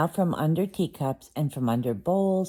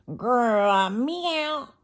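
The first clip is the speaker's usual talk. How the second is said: a much higher, louder vexed cat growl and meow; angry cat; 9 semitones higher, 4 dB louder than usual. Acted. Simpkin the cat bouncing in from the snow, vexed growl and meow. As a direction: growl then yowl like a cross cat. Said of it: "with an angry".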